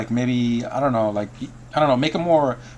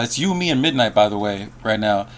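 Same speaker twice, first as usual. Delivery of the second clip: usual speaking voice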